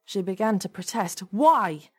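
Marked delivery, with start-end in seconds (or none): 1.3-1.9 loud, raised demand; sharp, louder, higher question. A narrator's reading. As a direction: demand an answer, exasperated. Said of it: "he demanded".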